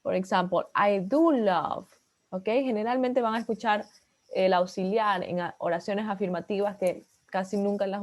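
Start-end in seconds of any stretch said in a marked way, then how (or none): 0.7-1.9 "do" said slowly and louder, leaning on it; stress on do. Shown as a demonstration. Teacher showing students the emphatic auxiliary, stressing "do". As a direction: press hard on "do" in "I do love". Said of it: "we say the auxiliary verb when we are making emphasis".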